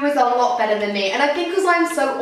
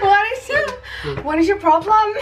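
a strained, high put-on Aussie voice; bad Australian accent; 8 semitones higher than usual. Neighbour snapping at the door in bad Australian. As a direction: hams up a terrible Australian. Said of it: "I can't do accents".

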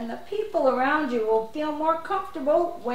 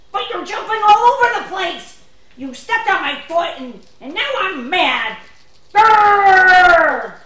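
getting mad; 4 semitones higher, 10 dB louder than usual. Acted. Stepped-on friend getting angry.

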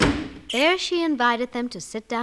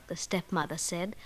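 ordinary delivery, nothing marked

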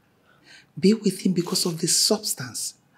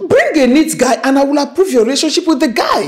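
higher and louder, laying down the law; loud demand; 9 semitones higher, 9 dB louder than usual. Acted. Mother setting her terms for the daughter, loud and firm. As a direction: raise the voice and insist.

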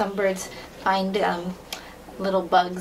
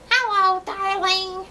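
high, squawky parrot echo of Hello, darling; 9 semitones higher, 3 dB louder, slower than usual. Pretend. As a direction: repeat it like a parrot. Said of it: "in a parrot voice".

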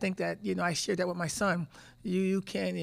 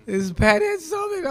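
awestruck; 11 semitones higher, 10 dB louder, much slower than usual. Retold or imitated. Awed recalling meeting icon.

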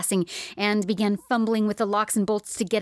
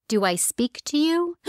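a higher, otherworldly voice; eerie spirit voice; 8 semitones higher than usual. Acted. Dead light addressing startled Miss Pretty, higher eerie voice. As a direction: let a ghostly light ask it, high and strange.

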